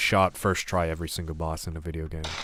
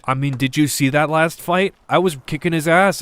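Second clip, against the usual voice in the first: excited; 9 semitones higher, 9 dB louder than usual. Real, not acted. Defensive boast about last fight.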